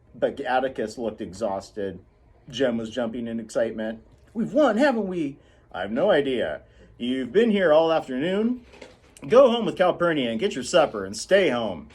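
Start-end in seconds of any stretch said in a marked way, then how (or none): 4.3-5.4 put-on high, excited boy's voice; eager Jem. Jem asking his father if they won, excited. 5.7-11.9 louder, firm fatherly voice; firm Atticus. Acted. Atticus telling his children to go home, firm.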